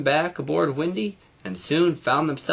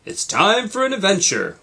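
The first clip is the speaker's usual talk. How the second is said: radio voice; 9 semitones higher, 5 dB louder than usual. A narrator's reading. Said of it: "Windy's radio announced".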